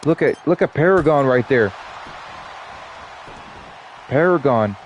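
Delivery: lively ringside announcer calling the action; play-by-play commentary. Acted. Streamer calling the wrestling match for viewers, announcer style. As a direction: call the match like a wrestling commentator, stretching the names.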